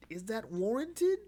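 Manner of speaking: can't believe what you just read; incredulous question. Retold or imitated. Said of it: "I'm like".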